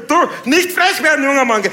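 viel höher, laut, jovial; bayerischer Polizist. Retold or imitated. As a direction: spiel den Landpolizisten bei der Kontrolle. Polizist wird wütend. (Polizist kontrolliert jovial, hoch und laut)

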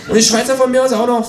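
high, breezy kangaroo voice. Acted. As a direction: toss it in in a high voice. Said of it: "fährt das Känguru fort".

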